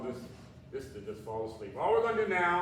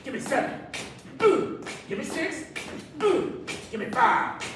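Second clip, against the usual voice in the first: high, loud pumped-up rep count; excited countdown; 8 semitones higher, 8 dB louder, slower than usual. Acted. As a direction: whoop between counts to fire them up. Counting down reps, whooping.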